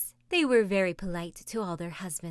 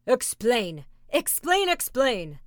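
chief demanding; 4 semitones higher, 6 dB louder, slower than usual. A narrator's reading. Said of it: "said the head chief".

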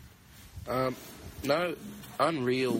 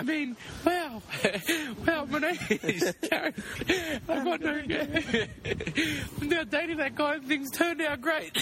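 put-on laughing girl voice. Girl character keeps going, laughing.

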